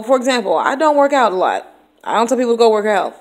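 ordinary delivery

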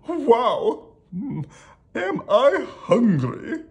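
high, loud, stretched-out royal exclamation; hammed-up hungry king; hungry storybook king. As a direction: draw it out like a pompous cartoon king.

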